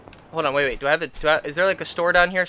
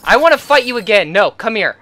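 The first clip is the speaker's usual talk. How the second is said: worked-up demand; 5 semitones higher, 7 dB louder than usual. Real, not acted. Demands a rematch.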